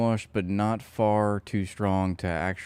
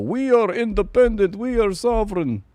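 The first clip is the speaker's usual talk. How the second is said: Netanyahu impression; 10 semitones higher than usual. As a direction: ham up a defiant leader's vow in a squeaky mock voice. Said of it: "it's like listening to Netanyahu".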